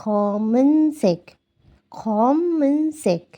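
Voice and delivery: খমেনসেক said very slowly; teacher modelling খমেনসেক slowly for learners. Shown as a demonstration. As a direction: stretch out খমেনসেক syllable by syllable. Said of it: "এই মটাকে এটার সাথে উচ্চারণ করি".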